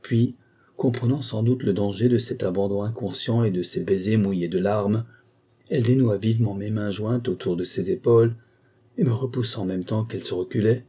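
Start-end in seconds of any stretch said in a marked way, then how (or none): none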